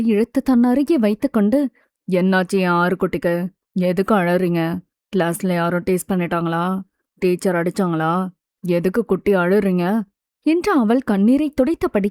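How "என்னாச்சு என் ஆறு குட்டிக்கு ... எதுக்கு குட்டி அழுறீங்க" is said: put-on man comforting a child. A narrator's reading. Mithul asking crying Aaru what happened, tender. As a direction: soothe gently in a lowered voice.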